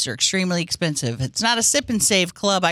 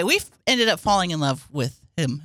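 ordinary talk

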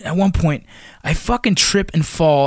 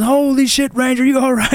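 imitating alarm in a high pitch; alarmed check; 10 semitones higher than usual. Instructor to ranger pulled from freezing river, imitated alarm. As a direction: exclaim it as a startled instructor would.

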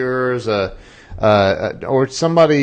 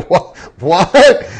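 higher, louder stunned "what?"; surprised; 6 semitones higher, 5 dB louder than usual. Retold or imitated. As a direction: blurt it out in disbelief. Hearing the dog poop business.

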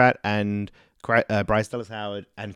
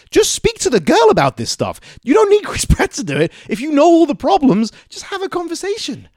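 much higher, louder exasperated plea; exasperated mock voice; 5 dB louder than usual. Mocking Chris Pratt telling Bryce to talk herself, high-pitched. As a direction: throw up your hands.

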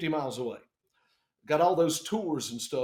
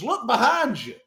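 loud, higher-pitched holler; imitated call-out; 6 semitones higher, 7 dB louder than usual. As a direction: call out like the arriving pilot. Pilot announcing his arrival, retold.

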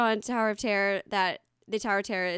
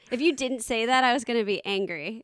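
ordinary talk, nothing marked

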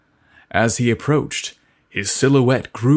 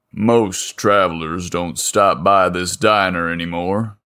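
a lower, easygoing small-town mechanic; burly mechanic; 5 semitones lower than usual. Acted. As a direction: chat lazily like the local big man. Voices Leo.

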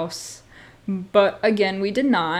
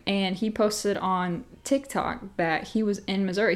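ordinary delivery

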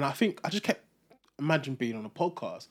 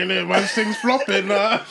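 mock moaning mate, higher and louder; mock dejected mate; 6 semitones higher, 9 dB louder than usual. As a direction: ham up a gutted friend's groan. Mock deflated reply to friend.

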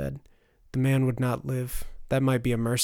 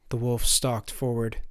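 plain reading voice

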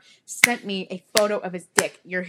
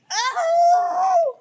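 real mortified cry, much higher and louder; embarrassed shriek; 8 dB louder than usual. Real, not acted. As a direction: yelp it out in genuine embarrassment. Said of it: "I'm so embarrassed".

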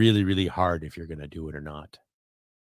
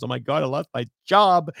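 mocking whine; 7 semitones higher, 5 dB louder than usual. Mocking laid-off staff.

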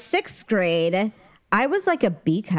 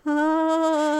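high mock wince of pain; a little sore gasp, higher; 6 semitones higher than usual. Sister acting out walking hunched over in pain, high pained ah.

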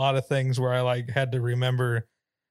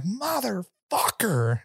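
put-on higher, slow, drawn-out curse from a tiny old aunt; old aunt cursing; 7 semitones higher, much slower than usual. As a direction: stretch out the swear like a cranky old lady. Aunt Betty cursing, voiced.

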